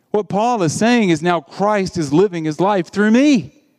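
normal talking voice

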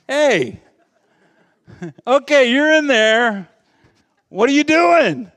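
high, loud acted challenge; mock-indignant shout; calling out. Acts out challenging someone inside him.

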